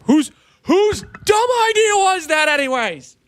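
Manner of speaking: high, loud exasperation; exasperated outburst. Real, not acted. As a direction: vent at the chat. Frustrated rant at chat.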